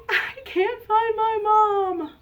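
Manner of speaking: high, slow tearful cub voice; mock-cry like a lost little one; whiny, drawn-out pretend sobbing; sniffling baby bear. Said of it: "sniffles and says".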